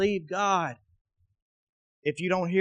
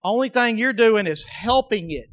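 ordinary talk, nothing marked

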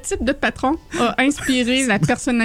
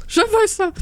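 excited; 11 semitones higher, slower than usual. Retold or imitated. Excited wanting the mini.

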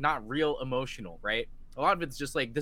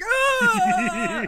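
mock anguished cry; 8 dB louder than usual. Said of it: "I was like".

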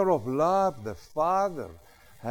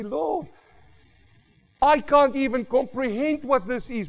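retold prayer; 12 semitones higher, 8 dB louder than usual. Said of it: "I say".

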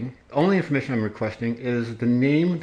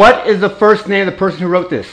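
loud, higher, insistent question; angry demand; 6 semitones higher, 9 dB louder than usual. Real, not acted. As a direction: press hard for the name. Demanding a name.

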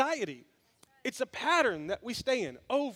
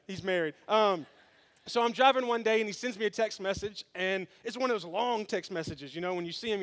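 ordinary talk

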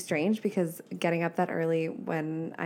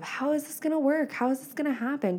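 high-pitched mock fretting; worried overdrive; 8 semitones higher than usual. Imagined dreamer fretting over how goals will work, mock-worried. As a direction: imitate a mind spinning with anxious questions. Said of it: "go into overdrive of".

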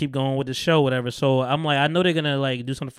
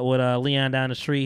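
normal talking voice

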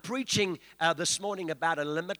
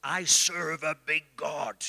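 put-on slow, deep African accent, big and weighty; slow heavy African preacher voice; 3 semitones lower, 4 dB louder, slower than usual. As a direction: boom it like an old African apostle. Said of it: "he would say in his deep African voice".

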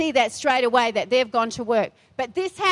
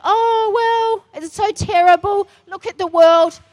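high, loud mock lament, Oh; hammed-up hand-wringing, much higher and louder; mock whining; 7 semitones higher, 7 dB louder than usual. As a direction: whine like a complacent churchgoer. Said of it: "sitting on our laurels going".

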